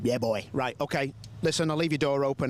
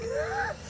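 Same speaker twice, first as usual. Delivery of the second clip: high-pitched laugh; 5 dB quieter than usual. Real, not acted. Laughs at the driver's age joke.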